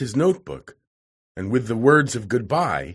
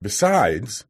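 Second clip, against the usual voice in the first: ordinary delivery, nothing marked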